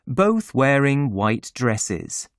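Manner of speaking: ordinary delivery, nothing marked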